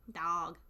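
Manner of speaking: put-on northern "dog", said dahg; northern pronunciation. Shown as a demonstration. Trying the northern way, "dog" as dahg. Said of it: "Y'all say dog".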